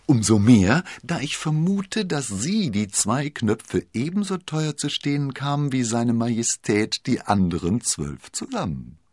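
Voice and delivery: high-pitched queenly sarcasm; mocking. A narrator's reading. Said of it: "blickte den Kardinal spöttisch an".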